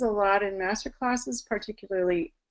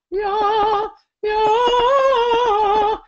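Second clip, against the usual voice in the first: high, loud, operatic yeahs; classical yeah; 12 semitones higher, 9 dB louder than usual. Shown as a demonstration. Voice teacher showing classical singers a classical yeah. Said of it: "I'll have them do a classical".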